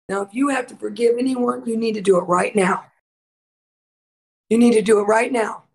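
usual speaking voice

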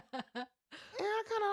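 high, slow, through a laugh. Real, not acted. Retelling the coffee story to viewers, laughing high and slow. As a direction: giggle through the words.

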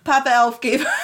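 laughter taking over the words; breaking into laughter. Real, not acted. Storyteller correcting a character's name, breaking up laughing. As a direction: start correcting, then dissolve into giggles.

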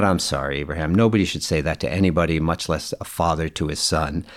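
low, quiet retold apology; soft apology. Father to the sobbing boy he cursed at, soft apology.

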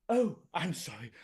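normal talking voice

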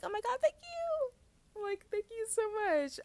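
overjoyed high-pitched thanks to the university; gushing gratitude. Retold or imitated. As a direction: gush like you just won the lottery. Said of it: "And I'd be like".

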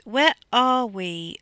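woozy, demanding drawl; slow, slurred drawl. A narrator's reading. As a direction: drag out each word, slurring. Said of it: "her slow, slurred drawl".

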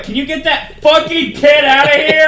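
loud, high imitation of outrage; mock angry demand. Moviegoer about a crying baby at Deadpool, mock angry. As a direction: yell like an annoyed moviegoer, half laughing.

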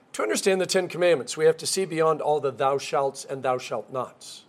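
ordinary talk, nothing marked

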